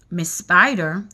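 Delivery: ordinary delivery, nothing marked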